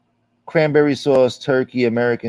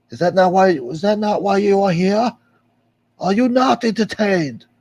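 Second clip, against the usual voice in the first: mock gladiator taunt; 10 semitones higher than usual. Gladiator crowd taunt quote.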